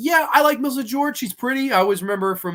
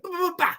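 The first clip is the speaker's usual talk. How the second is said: mock jump scare; 12 semitones higher, much faster than usual.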